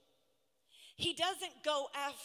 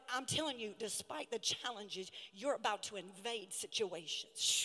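voice of God; 6 semitones lower than usual. Retold or imitated. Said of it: "and God said".

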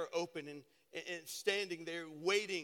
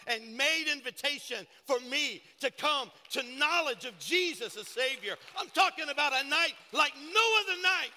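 louder and higher to a loud peak; building preaching; 5 semitones higher, 7 dB louder than usual. Real, not acted. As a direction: preach it up to the climax. Preaching builds to full force.

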